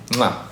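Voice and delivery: soft blown kiss, mwah; kiss sound. Real, not acted. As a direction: blow a kiss into the mic. Blowing kisses to fans.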